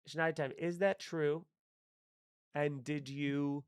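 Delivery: usual speaking voice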